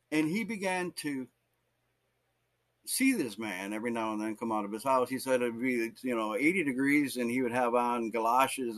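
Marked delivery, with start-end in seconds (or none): none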